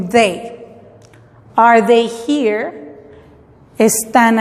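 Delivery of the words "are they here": slow, careful question with rising tune; slow teaching read. Shown as a demonstration. Teacher modeling the question slowly for students. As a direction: spell it out slowly for the class. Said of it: "No es lo mismo que are here".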